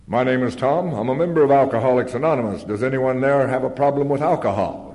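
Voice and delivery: loud, well-enunciated announcer delivery. Retold or imitated. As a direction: introduce yourself like a TV announcer. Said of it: "this guy's voice was well enunciated".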